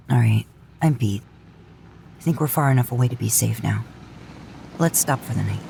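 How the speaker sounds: put-on low, weary older-brother voice; tired Dean. Dean telling Sam he's done driving, tired and low. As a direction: sound beat after a long drive.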